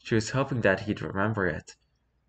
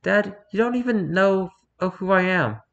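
high, raised-voice outburst; upset protest; 11 semitones higher, 6 dB louder than usual. A narrator's reading. Daughter protesting that dad doesn't know her, upset and loud. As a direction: burst out loud and high at him.

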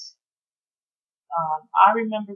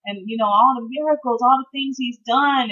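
higher, pleading lament growing louder; retold grieving lament; 6 semitones higher, 3 dB louder than usual. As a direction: grieve over a good man. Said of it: "And I just kept saying".

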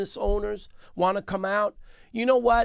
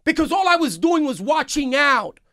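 raised, higher-pitched reproach; indignant confrontation; 4 semitones higher than usual. Retold or imitated. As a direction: confront the mayor with pent-up indignation. Retold angry confrontation with the mayor.

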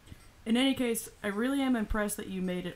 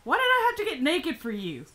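high loud outraged question; indignant shout; 8 semitones higher, 7 dB louder than usual. Pretend. Indignant voice to game.

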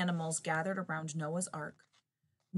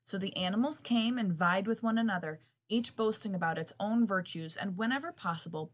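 plain reading voice

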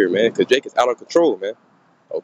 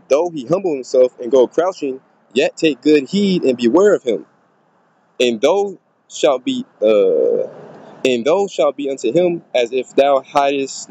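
slow, raised solemn scripture reading; 3 semitones higher, slower than usual. Acted. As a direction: read the verse slowly like a preacher. Reads scripture aloud.

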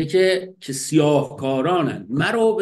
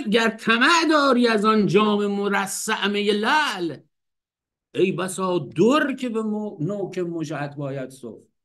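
slow, raised recital of the verse; poem recitation; 5 semitones higher than usual. Shown as a demonstration. Teacher reciting Hafez after laughing, slow raised poetic reading. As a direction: recite slowly and a bit higher. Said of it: "میگه که".

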